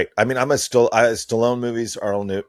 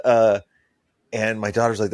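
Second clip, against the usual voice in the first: normal talking voice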